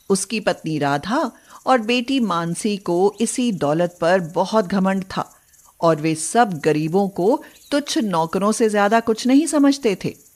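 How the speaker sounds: usual speaking voice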